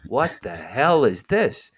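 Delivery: slow, drawn-out astonished question; stunned disbelief. Retold or imitated. Waiting for his sandwich, he gapes at the vision, astonished. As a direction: stare at the thing and ask slowly. Bewildered reaction at the deli.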